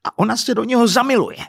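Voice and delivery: high, exasperated exclamation; indignant, pitch jumping up; incredulous outrage. Acted. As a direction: say it as the last straw. Husband's incredulous rant.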